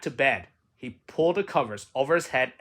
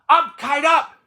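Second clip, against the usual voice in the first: shout it; 10 semitones higher, 7 dB louder than usual. Shown as a demonstration. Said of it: "and shout".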